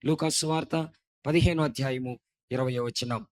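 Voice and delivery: ordinary talk, nothing marked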